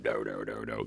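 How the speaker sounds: low, soft bass-note doos; imitated clean bass. Shown as a demonstration. Teacher showing how bass should sound, low soft doo sounds. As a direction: hum out deep, quiet bass notes. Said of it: "Instead of".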